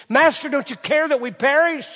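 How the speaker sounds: loud, high desperate cry; panicked disciples. Retold or imitated. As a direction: cry out in fear of the storm. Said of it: "what the disciples said".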